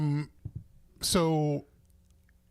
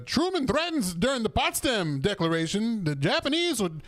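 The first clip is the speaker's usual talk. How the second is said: put-on old radio announcer; 7 semitones higher than usual. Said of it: "they're like".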